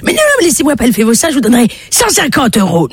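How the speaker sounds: fast, high-pitched begging; pleading old man. Acted. Old man raising his offer, pleading. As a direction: plead in a high hurried rush.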